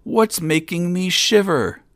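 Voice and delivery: high, loud, shivering astonishment; crying out. A narrator's reading. Young king shivering at last under wriggling fish, crying out. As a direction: wail it high, thrilled by the cold shock. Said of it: "he cried".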